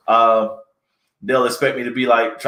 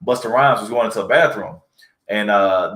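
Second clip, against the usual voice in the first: usual speaking voice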